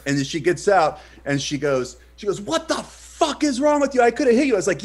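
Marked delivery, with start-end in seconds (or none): 2.4-4.5 high, loud outraged driver; pitched way up, indignant; retold furious confrontation. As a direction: act out an irate driver yelling. Furious driver confronts him in story.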